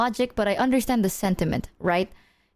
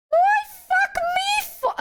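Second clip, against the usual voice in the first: squeaky outraged protest; slower than usual. Retold or imitated. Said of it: "And I'm like".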